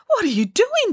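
very high, protesting little voice; indignant demand. Acted. Caged puppet confronts the ringmaster, indignant demand. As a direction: demand it in high-pitched alarm. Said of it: "demanded Bramwellio".

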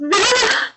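high, loud burst of a cry; explosive high shriek cutting the sentence; sudden outburst. A narrator's reading. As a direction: break off into a piercing wail. Sobbing breaks into an outburst.

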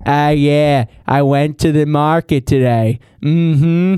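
put-on slow, drawn-out old aunt voice; great-aunt Bernadette. Great-aunt reports her market trip, imitated slow old-lady voice. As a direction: ramble slowly, agreeing with yourself. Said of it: "So she'll be like".

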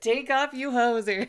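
a hint of a Canadian accent, laughing; loud Canadian hoser jab. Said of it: "Canadian accent is Strange Brew".

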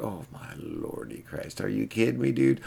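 hushed, stunned disbelief; mock amazement. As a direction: act floored by the money. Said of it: "I'm like".

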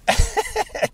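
high giggle; real amused giggling; laugh. Real, not acted. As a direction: burst into a short high laugh. Amused by listener's comment.

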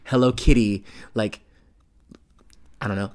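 ordinary delivery

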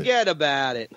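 Italian-American wise-guy accent; Italian-American catchphrase. Shown as a demonstration. Jersey host supplying the catchphrase, Italian-American accent. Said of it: "you'd be the first person they go to".

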